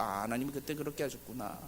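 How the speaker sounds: hushed, dawning understanding; quiet realization. Acted. Believer looking back on God's plan, quiet realization. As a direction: say it softly, as if to yourself.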